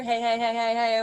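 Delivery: repeated chest-resonant "hey". Shown as a demonstration. Teacher locating the vibration, chest voice. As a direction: keep each "hey" buzzing in the chest. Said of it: "Do you feel it here".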